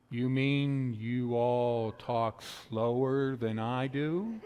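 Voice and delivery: slow and low, stretched out, teasing; mock slow drawl. Pastor teasing the southerner, slow mock drawl. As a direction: drag every word out slowly. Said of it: "So I kindly went".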